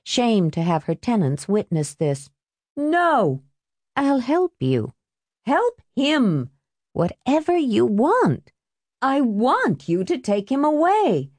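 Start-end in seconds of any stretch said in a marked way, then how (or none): 2.8-11.3 pleading exchange. A narrator's reading. Remembered plea at the apartment door.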